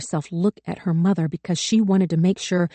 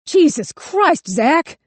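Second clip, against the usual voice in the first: much higher, louder, stretched-out yell; young gunman yelling at his partner in panic; panicked yell; 11 semitones higher, 6 dB louder, much slower than usual. A narrator's reading. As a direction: cry out in shock. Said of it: "Bon Jovi yelled".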